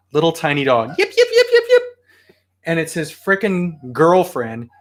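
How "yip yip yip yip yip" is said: high, quick yappy yips; small dog yips. Retold or imitated. Imitating the neighbors' little dog, high yips. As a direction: imitate a tiny dog yapping. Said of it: "little tiny yip yip dog".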